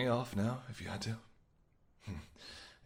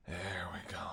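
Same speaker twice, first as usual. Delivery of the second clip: whisper; 4 semitones lower, 7 dB quieter than usual. Acted. Intimate seduction scene.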